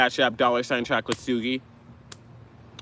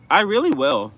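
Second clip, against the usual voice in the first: I really will, higher and louder; emphatic promise; 7 semitones higher, 7 dB louder than usual. Shown as a demonstration. Streamer promising chat loudly to keep the accent. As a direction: belt out the promise, stressing really. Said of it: "We should do the accent the whole show".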